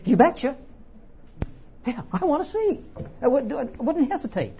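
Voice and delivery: high-pitched eager yes; eager reply. Acted. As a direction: jump at the offer without hesitation. His imagined eager answer to Jesus.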